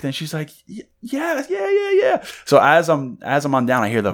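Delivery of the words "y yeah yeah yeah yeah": tiny, very high stunned yeses; soft squeaky stammered agreement; imitated shock. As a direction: play her too shocked to speak properly. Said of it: "like, utterly shocked".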